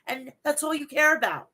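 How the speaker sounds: ordinary talk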